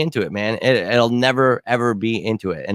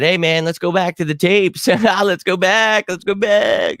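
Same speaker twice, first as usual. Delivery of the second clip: higher, louder teasing singsong, laughing mid-line; gloating taunt; 8 semitones higher, 3 dB louder, slower than usual. Retold or imitated. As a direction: rub it in with a grin you can hear. Taunting the host after Super Bowl win.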